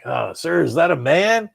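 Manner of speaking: high, loud gung-ho bellow; macho shout. Retold or imitated. Podcaster acting out the movie's ending, loud macho shout. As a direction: belt it out like a proud test pilot.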